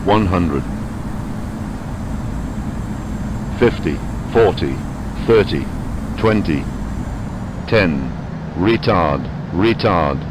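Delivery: low synthetic altitude callout voice; flat automated cockpit announcer; cockpit warning voice. Real, not acted.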